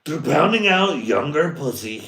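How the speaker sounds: loud, high punchline yell; mock shout. Yelling the punchline to the crowd, loud and high. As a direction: crank it up high and loud for the joke.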